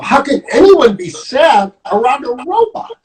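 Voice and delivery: high-pitched, incredulous question; baffled disbelief. Acted. Storyteller voices Piggy puzzled by a sad friend, incredulous. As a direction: wonder aloud, utterly baffled.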